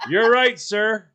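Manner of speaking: loud, high sarcastic yes, sir; mockingly agree, then spring the trap; mock agreement. Cop mocks the stoned driver: loud, high sarcasm.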